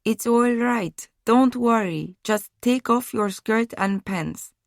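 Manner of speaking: slow, soft, kinder voice; quiet and kind. A narrator's reading. Woman calming the frightened girl, quiet and kind. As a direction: soothe her gently and slowly. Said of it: "quiet and kinder than it had been".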